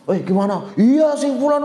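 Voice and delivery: high, loud mocking call of the name; loud gossip. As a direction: imitate someone talking loudly about a person. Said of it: "Kita ngomongnya keras".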